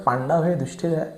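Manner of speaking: पांडव and युधिष्ठिर ending without an added aa; native Hindi endings. Shown as a demonstration. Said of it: "ऐसे कर देते हैं".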